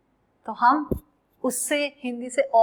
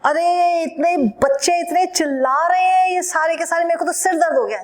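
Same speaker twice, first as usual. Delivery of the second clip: put-on higher and louder, fed up with noisy kids; playful grumble, pitched up and louder; mock annoyed complaint; 5 semitones higher, 6 dB louder, faster than usual. Acts annoyed adult with headache.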